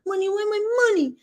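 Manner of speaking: high, fast mock whine; imitating Pedro. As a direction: mimic him demanding his money. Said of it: "he like".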